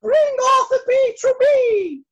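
very high, loud royal order; queen shouting. Acted. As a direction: bellow the command as an angry queen. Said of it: "she shouted".

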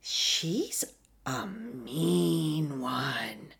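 slow, drawn-out whisper; whispered jibe. Acted. Host describing Erica's stone-faced act, whispered. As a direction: whisper it, stretching the last words out long.

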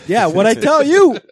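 high, loud, drawn-out boast; loud crowing. Real, not acted. Bruins fan claiming he called it, loud crowing. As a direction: crow it slowly with a laugh. Crowing over being proved right.

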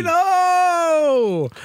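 very high, long drawn-out goal call; goal cry. Real, not acted. Celebrating his team's goal, high drawn-out cry. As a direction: stretch the scorer's name in a high cry.

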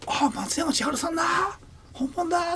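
高い声で本物に驚く観客の真似; 本物だと思って声を上げる; 驚きの歓声. Retold or imitated. 観客の驚きを再現. (観客の驚きを高い声で真似する)

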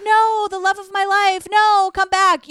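high, slow, overdone plea to a wife who left; hammed-up begging come back; mock pleading husband. Mocking the husband begging the wife who left, hammed-up plea. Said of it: "Drew was like".

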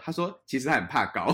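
usual speaking voice

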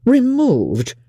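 high, startled cry of protest; shocked exclamation. A narrator's reading. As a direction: exclaim it high, falling away in disbelief. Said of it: "cried Bingley".

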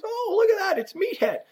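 very high, exaggerated fake delight; retold mocking taunt. Player jeers at the rep from outside, imitated mocking taunt. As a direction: swoop up high, then land on the nickname.

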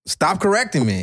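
retold irritation. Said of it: "I was like".

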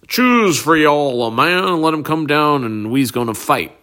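Goliath. Acted. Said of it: "Goliath says".

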